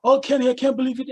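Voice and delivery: lightly voiced moaning wrestler; mock whiny wrestlers. Wrestlers barking at Kenny about the title, mock whine. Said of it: "they're all barking at him like".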